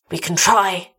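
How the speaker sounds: plain reading voice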